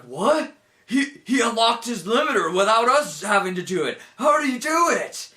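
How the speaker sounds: high-pitched, incredulous outburst; mock astonishment. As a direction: act out a stunned onlooker, rising in pitch. Acting out a stunned onlooker.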